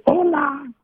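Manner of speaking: squawky high parrot hello. Retold or imitated. Pastor imitating his parrot, squawky high parrot hello. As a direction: mimic a parrot echoing back. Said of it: "el loro te repite".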